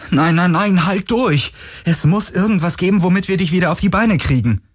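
high, rushing, desperate pleas; panicked pleading. Acted. Galen begs the dying ghost to hold on, panicked. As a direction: beg him to hold on, fast and frantic.

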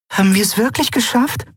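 high, tentative asking; cautious question. Acted. Nelen asks her friend cautiously, high and tentative. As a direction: ask hopefully, unsure. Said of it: "fragte Nelen vorsichtig".

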